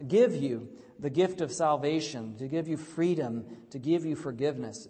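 rousing pulpit delivery; full-force preaching. Acted. Preaching the sermon.

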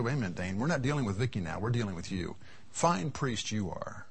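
a quiet, low divine correction; voice of the Lord. Retold or imitated. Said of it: "the Lord said".

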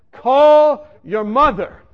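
a slow booming command that stops a man on a pier; commanding voice from above. Retold or imitated. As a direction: intone it like a voice from heaven. A voice speaks to him.

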